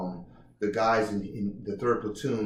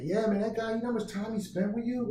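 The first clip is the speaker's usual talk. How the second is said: high, amazed buddy voice; a friend marveling at the attention; imitating an excited buddy; 11 semitones higher than usual. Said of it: "he was like".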